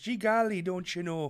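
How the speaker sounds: high, folksy Midwest lilt; mock Midwest accent. Shown as a demonstration. Guessing the Wisconsin accent, high mock Midwest voice. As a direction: put on a cartoon Midwest accent.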